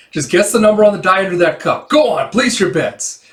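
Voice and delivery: high, loud gambler patter; insistent gambler. Acted. Gambler swearing off tinkering to doubters, loud insistent showman voice. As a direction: dare them to place their bets. Acts gambler performing for bettors.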